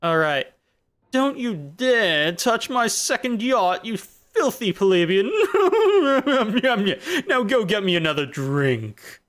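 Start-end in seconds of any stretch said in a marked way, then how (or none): 1.1-5.3 high, slow snooty rich voice; loud bragging new money. Pretend. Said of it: "I hate myself for doing that voice". 5.4-7.2 shrill laughing burst; high cackle. Real, not acted. Laughing at own rich voice. 7.3-9.2 high, slow snooty rich voice; loud bragging new money. Pretend. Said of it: "I hate myself for doing that voice".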